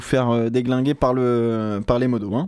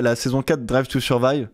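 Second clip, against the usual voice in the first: ordinary talk, nothing marked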